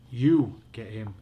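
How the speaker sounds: loud and slow, pushing it back on her; you do it, said loud and slow; loud refusal. A narrator's reading. Boy refusing to fetch the smelly dog, loud. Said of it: "Josh cried".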